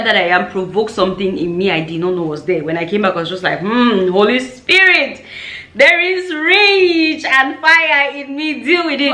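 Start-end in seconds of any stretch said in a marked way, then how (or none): 3.6-9.1 high, drawn-out dramatic invocation; theatrical fiery prayer; mock fervent plea. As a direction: call on the Spirit, slow and high. Acted fiery prayer on returning.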